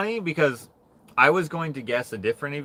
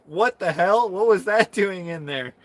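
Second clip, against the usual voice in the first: higher, louder, disgusted outburst; mock disgusted surprise; 6 semitones higher, 4 dB louder than usual. Classmate pulling his drooling hand out, mock disgusted. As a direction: recoil at the slimy hand.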